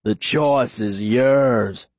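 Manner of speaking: very slow, low-pitched menace; scary calm. A narrator's reading. Aaron leaving Todd the choice, slow scary calm. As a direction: draw it out with chilling calm. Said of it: "still with the calm that's so much scarier".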